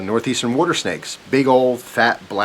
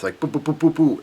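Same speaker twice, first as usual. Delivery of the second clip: playful boo boo boo, rising higher; imitated bumping; 6 semitones higher than usual. Telling a friend about the kayak bouncing, high playful boo sounds. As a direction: mimic the kayak thudding on the posts. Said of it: "it's bouncing back and forth".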